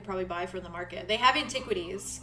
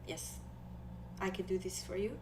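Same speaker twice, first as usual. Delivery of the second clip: put-on softer, accented character voice; Nori, shy and willing; 2 semitones lower, 13 dB quieter than usual. Nori offers a friend polish, quiet accent.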